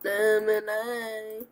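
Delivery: drawn-out goofy voice; slow silly voice. Pretend. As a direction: stretch it out, very slow. Same slow put-on.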